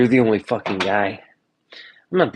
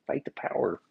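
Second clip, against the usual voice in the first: much softer, under the breath; muttered repeat; 2 semitones lower, 9 dB quieter than usual. Pretend. Repeating the protesters' slogan, muttered softly. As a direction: mutter it to yourself.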